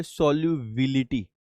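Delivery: Solubility said low, soft and slow; soft slow model answer. Shown as a demonstration. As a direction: draw out solubility softly for the class. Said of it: "इसको हम लोग क्या बोलते हैं?".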